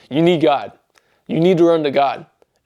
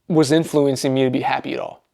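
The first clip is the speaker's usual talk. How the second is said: ordinary talk, nothing marked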